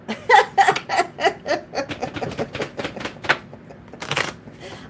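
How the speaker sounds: breathless unvoiced laugh; real laughter. Real, not acted. Tarot reader to viewer, breathless laughing. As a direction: cracks up, silent wheeze. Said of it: "I'm sorry I'm laughing".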